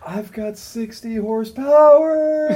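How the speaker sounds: high, loud, slow bragging; mock boast. Mock boast quoting the rider.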